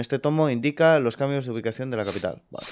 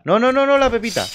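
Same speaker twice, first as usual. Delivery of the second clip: high, urgent string of noes; panicked protest; 10 semitones higher, 4 dB louder than usual. Real, not acted. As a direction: cry out high as it slips away. About to lose the gold nugget.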